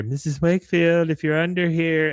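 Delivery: high-pitched, timid searching call; nervous girl calling. Retold or imitated. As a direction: mimic a girl calling under a bed. Voices girl searching under bed, calling.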